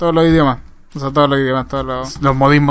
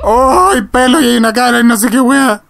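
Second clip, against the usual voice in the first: high, alarmed outburst; alarmed cry; 8 semitones higher, 4 dB louder than usual. Real, not acted. Player finding hair and a face in the game, alarmed.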